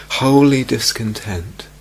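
Holy said slow and weighty; stress on holy. Shown as a demonstration. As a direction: lean on Holy, slowly. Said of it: "That's H O L Y".

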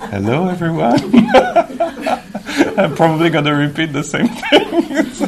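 high, helpless laughter through the words; laughing. Real, not acted. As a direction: crack up while talking, voice rising. Amused by his own repetition.

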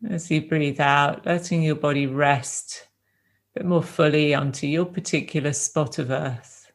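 gentle, unhurried meditation guidance with long silences between phrases; guided meditation. Real, not acted. Teacher guiding a group to rest in the body, calm meditation voice. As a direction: ease them into stillness, leaving space after each phrase.

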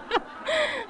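a high, delighted laugh; high burst of laughter. Real, not acted. Speaker laughing after praising the monk, high laugh.

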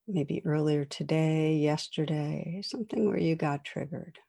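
soft spoken reflection prompt; guided meditation. Acted. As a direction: guide listeners through calm reflection.

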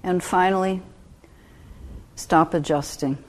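calm meditation guide with long pauses; unhurried, even instructions with space to breathe; guided meditation. Real, not acted. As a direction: ease listeners into stillness, phrase by phrase.

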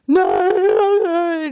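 put-on high, loud child's refusal; a loud high squeaky no; mock panicked no. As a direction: blurt it out like a frightened kid. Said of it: "not even together enough".

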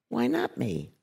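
lightly voiced dawning acceptance; calm realization. [name] recalling her thought, musing calmly. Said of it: "I'll think to myself".

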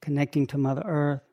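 calm, unhurried meditation guidance; guided meditation. Acted. Teacher guiding the group's breathing, in a calm meditation voice. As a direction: ease them into their breath.

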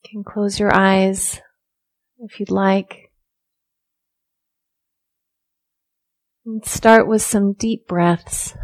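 soft, unhurried meditation guidance with long silences; guided meditation. Real, not acted. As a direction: ease listeners into stillness, softly, leaving room to breathe.

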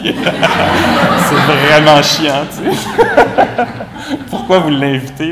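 loud, drawn-out laughing gripe; mock complaint. Voicing the annoyed participant's note, loud and laughing.

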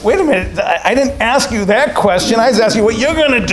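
high, loud, rushed retelling of his startled objection; flustered protest. Retold or imitated. To the old monk who asked about death, a high flustered protest. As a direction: sputter back, caught off guard. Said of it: "you could tell I was kind of upset".

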